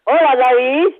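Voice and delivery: bright, loud, delighted hello; light up hearing his voice; delighted greeting. Real, not acted. Grandmother greets grandson on phone.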